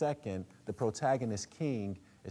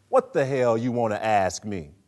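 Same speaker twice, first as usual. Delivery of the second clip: louder gruff father's voice; Troy; 2 semitones higher, 12 dB louder than usual. Acted. Father snapping at his son, louder. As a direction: bark it back at the boy. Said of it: "where Troy".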